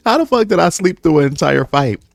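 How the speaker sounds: how is that possible; disbelief. Real, not acted. Telling viewers, high amazed disbelief. Disbelief at sleeping through fight.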